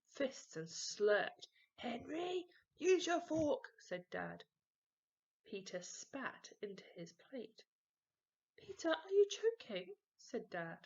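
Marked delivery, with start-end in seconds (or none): 1.7-3.7 firm, raised fatherly telling-off; stern dad voice. A narrator's reading. Dad scolds Henry. 8.6-9.9 high, loud alarmed question; worried dad. A narrator's reading.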